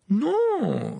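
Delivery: high, drawn-out false "no"; unconvincing denial. A narrator's reading. Ron hiding the newspaper from Harry, unconvincing denial. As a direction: deny it far too unconvincingly. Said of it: "d'un ton qui ne pouvait convaincre personne".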